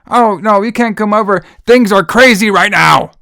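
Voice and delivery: high, loud mock yell; mock screaming. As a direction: bellow each line like a bad child actor. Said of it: "she pretty much delivered her lines exactly that way".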